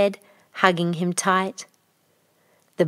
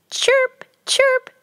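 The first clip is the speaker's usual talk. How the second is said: very high, cartoonish cricket chirps; 4 dB louder than usual. A narrator's reading. Unseen cricket calls to Tinku in the night, high chirping. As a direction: chirp like a little cricket, pitched way up.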